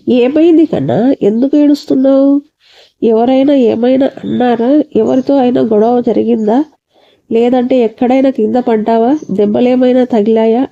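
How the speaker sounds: usual speaking voice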